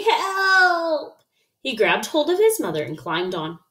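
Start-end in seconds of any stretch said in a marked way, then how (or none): 0.0-1.2 high, long wailing call; cry for help. A narrator's reading. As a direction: call out in storybook panic. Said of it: "cried Froggy".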